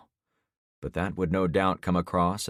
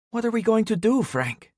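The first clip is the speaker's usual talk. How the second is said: high, anxious plea; urgent worried question; 4 dB louder than usual. Acted. Carmen nervous, asking Frank.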